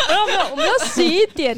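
loud laughing protest through giggles. Real, not acted. Laughing at friends' teasing about sponsored ads, loud and high.

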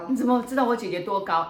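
a client caught off guard, asking how the fortune teller knew, startled and sharp; startled question. Retold or imitated. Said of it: "很冷了一下说".

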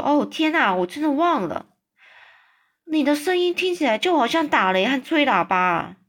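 lightly voiced little girl, startled and sorry; girl's dismayed voice.

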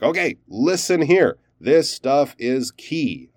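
deliberate emphasis on listen here and key; emphatic attention-getter. Acted. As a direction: flag it for the listener, weighting each phrase. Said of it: "it's kinda like".

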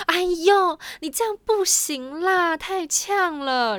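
high, loud, drawn-out aiyo; excited exclamation. Retold or imitated. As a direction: burst out in excited alarm, slowing at the end. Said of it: "他就马上情绪高涨哦".

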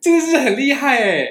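high and loud, laughing in disbelief. Real, not acted. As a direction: squeal while laughing.

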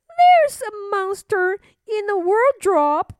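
put-on high, loud child voice; alarmed little princess. Princess telling king about wardrobe monster, alarmed. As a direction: raise the alarm as a little girl.

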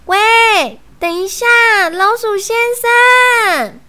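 high, loud shout after someone; calling out. Acted. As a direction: call to someone walking away, drawing out the end. Fox calls after departing Mr Mouse.

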